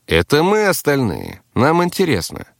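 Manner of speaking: high-pitched sly prodding; snide encouragement. A narrator's reading. Narrator prods the captive who broke off, snidely. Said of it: "ехидно приободрил его я".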